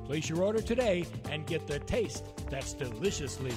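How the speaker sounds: upbeat ad read; home shopping host. Acted. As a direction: sell it like a commercial. Mellow Mushroom ad read.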